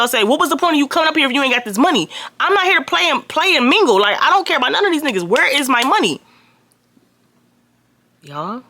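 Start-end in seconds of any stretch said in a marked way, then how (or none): 0.2-6.2 high, fast furious rant; furious demand. Retold or imitated. Demanding her money from Tyrell, fast furious rant. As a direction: rattle it off high and fast, outraged.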